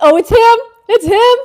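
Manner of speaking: loud, high burst of real excitement; excited outburst. Real, not acted. Reader spots the character in the story, excited outburst. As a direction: blurt it out, thrilled and high.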